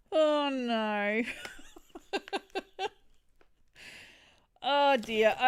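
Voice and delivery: loud, long oh no breaking into laughter; amused groan at being pranked; laughing dismay. Real, not acted. Laughing at the cheeky gift.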